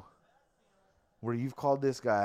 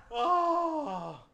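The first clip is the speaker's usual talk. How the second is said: laughing; 7 semitones higher than usual. Real, not acted. Laughing at own mix-up.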